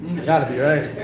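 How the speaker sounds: normal talking voice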